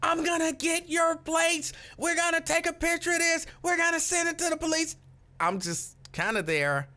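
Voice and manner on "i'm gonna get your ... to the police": high, determined voice of the angry worker; indignant worker. Retold or imitated. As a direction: mimic the worker threatening to report him. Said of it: "So she's like".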